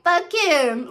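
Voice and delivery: loud, high outburst of loyalty; drunk best friend. Retold or imitated. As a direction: imitate a tipsy friend taking her side. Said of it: "'cause we both drunk".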